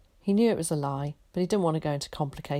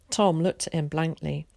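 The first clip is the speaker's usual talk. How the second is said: plain reading voice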